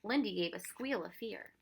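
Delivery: ordinary delivery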